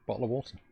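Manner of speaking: soft bottle of water with caught t's; glottal stop. Shown as a demonstration. Streamer showing how easy it is, soft glottal stops. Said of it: "It's a glottal stop".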